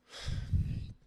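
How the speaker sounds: quick unvoiced chuckle; breathy laugh. Real, not acted. Laughing at own joke.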